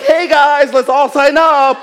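peppy call to sign up; mock cheerful rally. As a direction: sell the sign-up to silent members. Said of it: "So they're like".

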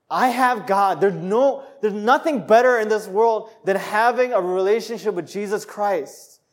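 high, loud declaration; fervent preaching. Acted. Preacher declares to the congregation, high and loud. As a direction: proclaim it with full conviction.

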